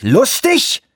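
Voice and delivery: laut und hoch, fassungslos nachgefragt; wütender Schrei. A narrator's reading. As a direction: das Wort empört herausschreien. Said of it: "schrie Anawak". (wütend geschrien)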